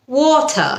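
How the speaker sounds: "ওয়াটার" as WAW-tuh; crisp t and open ending on "ওয়াটার"; British pronunciation. Shown as a demonstration. Teacher advises accent imitators, "ওয়াটার" as British WAW-tuh. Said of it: "British accent অনুকরণ".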